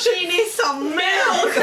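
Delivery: laughing through the words. Real, not acted. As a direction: blurt it out mid-laugh. Joke amid table laughter.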